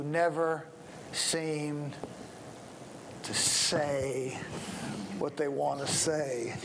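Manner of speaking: drawn-out, halting slow speaker; mock slow speaker. Shown as a demonstration. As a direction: drag each word out with long gaps. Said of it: "slow, slow speakers".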